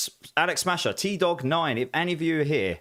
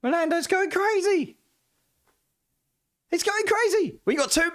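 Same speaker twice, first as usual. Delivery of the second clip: squeaky disbelief, very high; high astonished voice. Real, not acted. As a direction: shriek it in amazed disbelief. Excited at gift subs.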